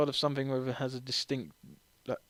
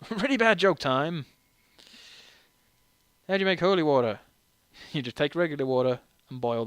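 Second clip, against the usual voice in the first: cheesy joke host; 3 semitones higher, 6 dB louder than usual. Pretend. Gamer announces a really bad joke to viewers, hammed-up loud announcer. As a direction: ham up the bad joke intro. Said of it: "oh yeah, really bad joke".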